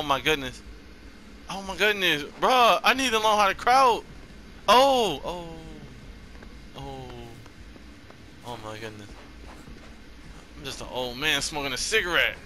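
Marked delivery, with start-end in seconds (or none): none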